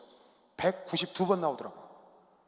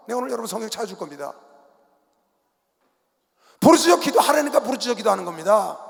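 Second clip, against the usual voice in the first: louder emphatic preaching; fiery preaching; 5 semitones higher, 7 dB louder than usual. Real, not acted. Pastor defending loud prayer, preaching hard. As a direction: hammer the point home.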